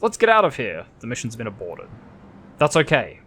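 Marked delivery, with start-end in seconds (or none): 0.0-0.9 higher-pitched urgent call, not a full shout; lightly acted shout to flee; narrated shout. A narrator's reading. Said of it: "Arthur Ender shouts".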